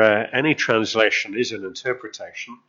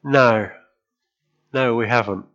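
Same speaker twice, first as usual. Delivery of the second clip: admitting; 4 dB louder than usual. Retold or imitated. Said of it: "they admit".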